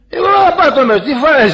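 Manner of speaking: high, loud mock outburst; indignant retort. Believer protests at leaving it to God, loudly indignant. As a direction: burst out in mock protest at the advice.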